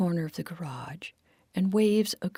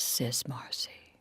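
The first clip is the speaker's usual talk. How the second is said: ordinary delivery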